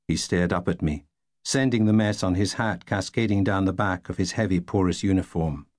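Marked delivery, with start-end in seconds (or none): none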